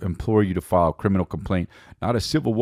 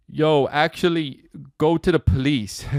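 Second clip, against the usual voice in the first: slow, higher mock swagger; 7 semitones higher, slower than usual. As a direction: play it cool and smug. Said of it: "He was just like".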